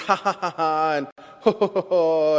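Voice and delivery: mock hearty ha ha and ho ho; imitated guys laughing. Newcomer mimicking the circle of laughing guys, mock hearty laughter. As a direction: act out a circle of guys guffawing. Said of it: "they're laughing and".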